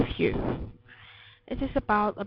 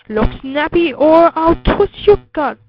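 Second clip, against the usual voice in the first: loud, rising beast's threat; threatening shout; 4 semitones higher, 11 dB louder than usual. A narrator's reading. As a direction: shout the threat as a bossy tummy monster. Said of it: "It shouted".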